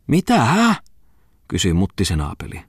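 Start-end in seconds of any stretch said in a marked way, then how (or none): 0.1-0.7 sharp high surprised question; startled. Acted. Muttinen startled by Falk's plan, high surprised question. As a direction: jump up in surprise.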